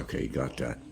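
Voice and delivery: ordinary talk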